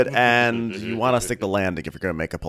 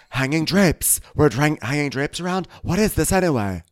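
high, louder Whitney voice; gushing Whitney; 9 semitones higher, 4 dB louder than usual. Retold or imitated. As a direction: claim it like an eager Whitney. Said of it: "Whitney's like".

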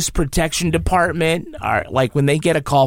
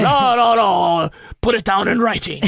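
mock Irish priest; 7 semitones higher than usual. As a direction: shout it like a stern Irish priest. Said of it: "You sound like what's his face".

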